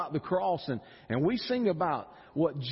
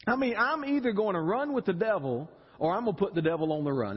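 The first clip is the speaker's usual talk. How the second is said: ordinary talk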